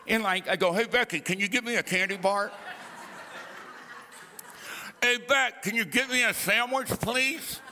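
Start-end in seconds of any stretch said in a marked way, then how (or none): 0.7-2.5 fast, laughing words out of the side of the mouth; side-of-mouth talk. Shown as a demonstration. Preacher shows his old side-of-mouth habit to the congregation, fast and laughing. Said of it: "talked out of the side of my mouth". 5.0-7.6 side-of-mouth talk. Shown as a demonstration. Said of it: "talked out of the side of my mouth".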